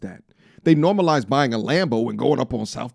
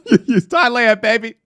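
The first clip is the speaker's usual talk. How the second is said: high, loud correction of a mistake; correcting a friend who keeps saying Taiwan, high and loud; exasperated correction; 9 semitones higher, 7 dB louder than usual. Real, not acted. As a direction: set him straight, voice jumping up. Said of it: "He keeps saying Thai-, Taiwan".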